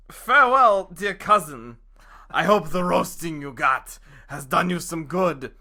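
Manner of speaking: loud, gloating send-off; mocking fox farewell. The fox mocks the wolf as he runs off, loud and gloating. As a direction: sneer it out as the triumphant trickster.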